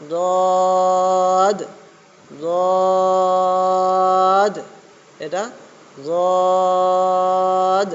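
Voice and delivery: জদ loud and heavy, drawn out; emphatic dad. Shown as a demonstration. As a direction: sound জদ full and heavy from the back. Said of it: "তাহলে এটা".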